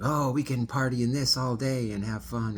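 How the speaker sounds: thrilled about the car; animated delight. Retold or imitated. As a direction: passenger gushing over the fancy car. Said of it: "she's talking like this, very animated".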